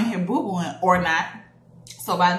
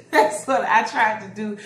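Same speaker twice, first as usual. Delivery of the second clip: ordinary talk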